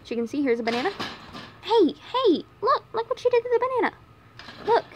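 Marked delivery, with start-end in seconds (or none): 1.6-4.9 much higher, fast excited pet-talk; excited squeal. Real, not acted. Vlogger squealing to her bunnies about the chewed banana.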